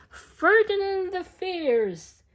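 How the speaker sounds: big, showy storyteller proclamation; grand announcement. Acted. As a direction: announce it like a ringmaster. Grand name in bull ring.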